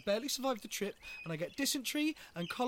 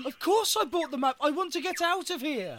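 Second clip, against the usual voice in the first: exasperated shout; 6 semitones higher, 6 dB louder than usual. Acted. Exasperated man, desperate.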